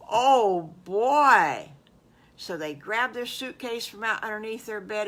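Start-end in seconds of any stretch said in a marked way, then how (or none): 0.1-1.7 put-on loud delighted kid cheer; happy cheer from the kids; thrilled cheer. Boys thrilled by news.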